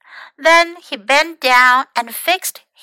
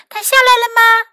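asking; 10 semitones higher, 5 dB louder, faster than usual. A narrator's reading. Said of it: "平基问".

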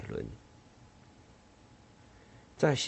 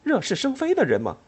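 high, louder angry retort; 13 semitones higher, 4 dB louder than usual. Acted. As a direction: spit the question out in anger. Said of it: "他生气地说".

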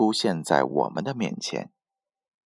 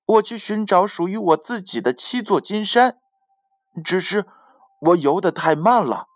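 proud; 8 semitones higher, 4 dB louder than usual. A narrator's reading. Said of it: "海马骄傲地说".

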